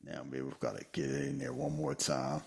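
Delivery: normal talking voice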